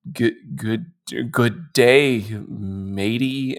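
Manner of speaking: halting, soft, low stab at Australian; failed Australian accent. Acted. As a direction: fumble through a bad Australian accent. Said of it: "couldn't do an Australian accent".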